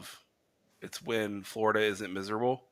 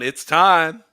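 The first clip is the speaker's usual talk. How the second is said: loud, higher mock holler; retold yell; 7 semitones higher, 10 dB louder than usual. Co-host imagining Vince plugging in the lights, mock yell. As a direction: yell it out like an excited announcement. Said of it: "He's yelling".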